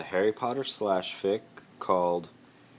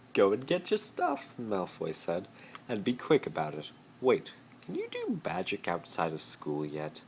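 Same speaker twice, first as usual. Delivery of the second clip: much higher, commanding; bossy Malfoy; 4 semitones higher than usual. Acted. Malfoy ordering Harry to pack, high and bossy. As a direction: order him about.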